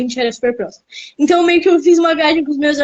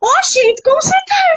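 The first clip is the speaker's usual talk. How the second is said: high-pitched, hammed-up Bahian accent; forced Bahian accent. Guest mimicking a friend greeting children, forced Bahian accent. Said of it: "amigo que forçava o sotaque".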